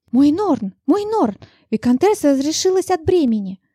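high, joyful announcement; joyful midwife. A narrator's reading. Midwife waking the master with news of a birth, joyful. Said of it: "Радостный голос акушерки".

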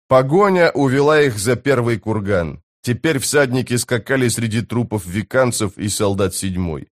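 ordinary delivery

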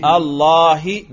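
"اللہ" ending in a clear -hi; جر کا تلفظ. Shown as a demonstration. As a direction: say "اللہ" as Allaahi, drawn out and loud, final i sounded. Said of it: "جر پہ کرو". ("اللہ" کے آخر میں زیر، یعنی اللّاہِ)

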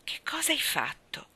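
high, partly whispered question; hushed question. A narrator's reading. Anna pressing Davy to confess, hushed question.

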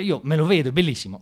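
normal talking voice

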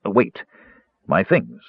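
plain reading voice

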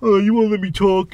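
high, whiny mock of a complaint; mocking whine. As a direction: whine it back at him to tease.

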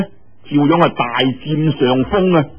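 ordinary delivery

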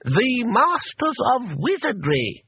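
slow, high, grand announcement; lofty, drawn-out self-importance; haughty. A narrator's reading. Locked-in wizard announces himself through the door, haughtily. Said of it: "said the voice haughtily".

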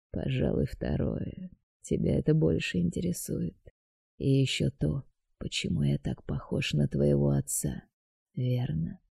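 quiet sinister deity introducing himself; dark god voice. A narrator's reading.

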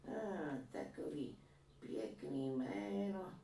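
a granny boasting about her son's painting; proud old woman. A narrator's reading. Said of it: "řekla". (vyšší pomalý hlas pyšné stařenky)

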